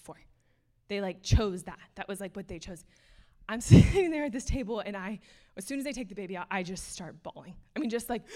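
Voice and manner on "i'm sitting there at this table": laughing through tears. Real, not acted. Emotional retelling, laughing through tears.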